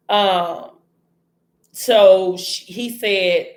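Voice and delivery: ordinary talk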